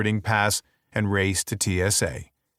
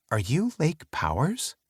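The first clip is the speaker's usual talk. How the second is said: higher, questioning woman's voice; female attendant voice; 6 semitones higher, 4 dB quieter than usual. A narrator's reading. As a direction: lightly voice the young attendant. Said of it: "She squints".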